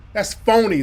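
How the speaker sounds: ordinary delivery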